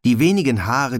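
ordinary delivery, nothing marked

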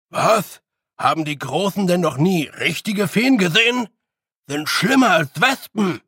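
indignant Feegle. A narrator's reading. Big Jan scoffing at pretty fairies, indignant Feegle voice.

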